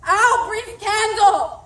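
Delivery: high, loud, slow outcry; anguished cry. Acted. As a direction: wail it out in despair. Macbeth cries out in despair.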